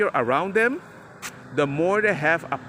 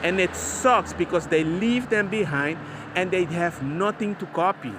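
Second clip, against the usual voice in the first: ordinary talk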